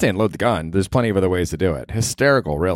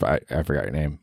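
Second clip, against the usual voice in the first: normal talking voice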